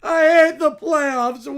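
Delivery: put-on loud, slow crying kid whine; bratty child. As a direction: wail like a spoiled child. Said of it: "the Brat Pack".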